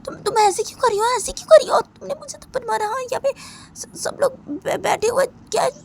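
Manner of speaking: tearful shock. Acted. Slapped Sunaina asking Simran why, crying and stammering. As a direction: choke out the words through tears.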